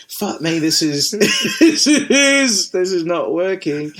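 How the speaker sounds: high, laughing retold exasperation; laughing disbelief. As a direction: crack up while quoting your own despair. Retelling hung-up call, laughing.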